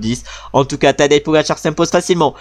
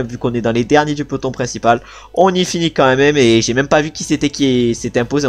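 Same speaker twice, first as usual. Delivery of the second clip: normal talking voice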